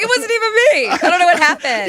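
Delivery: high, fast-rising panicked squeal; panicky reporter. Pretend. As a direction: play a flustered reporter caught out. Mocking a fined traffic reporter.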